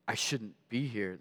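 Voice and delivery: soft, low and slow realization; sobered inner thought. Retold or imitated. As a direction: let the recalled thought land quietly. Sobered thought recalled in story.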